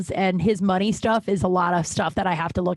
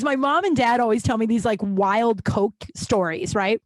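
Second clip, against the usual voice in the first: ordinary talk, nothing marked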